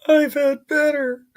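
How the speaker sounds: hammed-up impression of an unimpressed guy; mimicking someone's reply. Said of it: "well, he's like".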